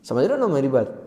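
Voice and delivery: ordinary talk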